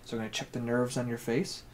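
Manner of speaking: quiet, hushed exam talk; soft ASMR voice. Acted. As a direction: speak softly like an ASMR video.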